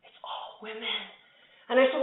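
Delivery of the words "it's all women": very soft mock-horrified confiding; hushed confession. Friend admitting over lunch what is different, hushed mock horror. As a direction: drop to almost nothing, as if sharing dreadful news.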